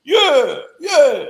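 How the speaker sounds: loud, hammed-up boast, chest puffed out; mock bragging shout. Mocking a braggart he refuses to be, loud mock boasting. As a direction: shout it like a show-off who won't shut up. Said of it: "Do I walk around twenty-four hours a day with my chest out?".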